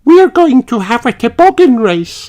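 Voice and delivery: high, sing-song little lamb voice; a chirpy greeting from a friend; Susie Sheep. A narrator's reading. Susie Sheep greeting Peppa and George, high chirpy voice.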